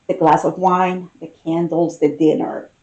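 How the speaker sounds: usual speaking voice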